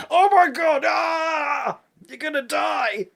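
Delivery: high, loud hammed-up shriek of doom; overkill panic shout. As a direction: shout the warning completely over the top. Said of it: "he's going".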